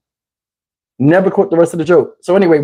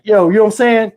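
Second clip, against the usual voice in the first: usual speaking voice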